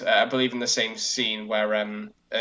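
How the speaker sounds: ordinary talk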